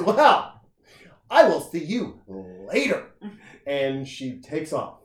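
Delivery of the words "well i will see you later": very high, loud mock-huffy exit line; huffy bar girl. Offended girl announcing she is leaving, mock huffy voice. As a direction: imitate her flouncing off in a high voice. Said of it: "And she goes".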